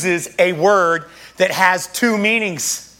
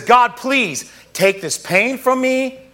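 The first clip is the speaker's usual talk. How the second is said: acted pleading; 5 semitones higher than usual. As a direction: beg for rescue, pitch held high. Psalmist pleading with God for relief.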